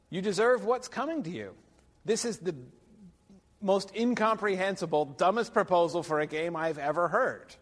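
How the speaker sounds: high, loud mock indignation; scornful paraphrase. Lecturer voicing Arthur's reply to the Green Knight, scornful. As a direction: throw it out as an incredulous put-down.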